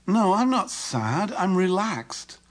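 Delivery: high, very slow, wavering denial; drawn-out, unconvincing claim; incongruent insistence. Retold or imitated. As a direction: stretch out the protest so it contradicts the words. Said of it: "incongruent with her words".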